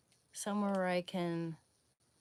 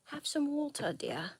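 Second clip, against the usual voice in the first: "Have some water" in a higher British lilt, "dear" dropped; mock British; 8 semitones higher than usual. Shown as a demonstration. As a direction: offer water like a British auntie. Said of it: "British people say water".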